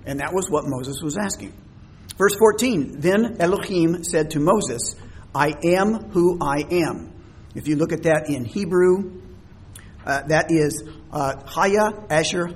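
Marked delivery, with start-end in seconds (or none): none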